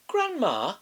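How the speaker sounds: put-on high, bright child calling; little girl voice. A narrator's reading. Girl at grandma's door, high child's call.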